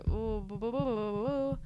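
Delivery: ordinary talk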